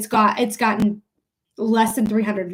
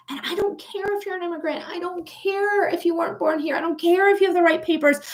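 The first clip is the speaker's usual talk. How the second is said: exasperated, building; 6 semitones higher than usual. Real, not acted. Streamer defending immigrants, high building exasperation. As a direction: build insistently on each "don't care". Impassioned rant building to outburst.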